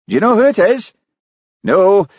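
put-on high, anxious young Scots voice; Charlie, nervous Highland constable. A narrator's reading. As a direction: sound spooked and uneasy.

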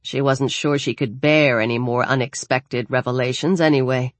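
plain reading voice

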